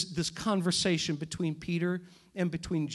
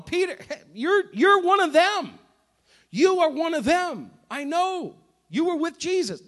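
higher, louder pointed accusing voice; loud accusation; 9 semitones higher, 7 dB louder than usual. Retold or imitated. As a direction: call him out in front of everyone. Second accuser pointing at Peter.

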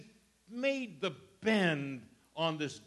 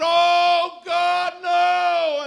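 high panicked shout as he falls; terrified cry; 12 semitones higher, 11 dB louder, much slower than usual. Retold or imitated. Man slipping off the cliff cries out to God, shouting in terror. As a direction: scream it as you slip over the edge. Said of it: "he said".

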